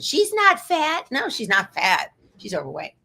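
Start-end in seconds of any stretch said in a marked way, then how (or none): 0.0-1.1 slow, higher mock of her husband; imitating a husband defending the dog; lightly mocking husband, slow and higher. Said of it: "You sound like my husband".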